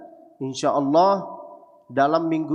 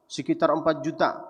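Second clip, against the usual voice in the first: ordinary delivery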